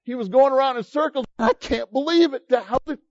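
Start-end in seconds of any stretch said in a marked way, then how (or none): 1.4-3.0 higher, rushed giddy amazement; giddy disbelief. Retold or imitated. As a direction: act out a man dizzy with joy. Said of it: "became giddy".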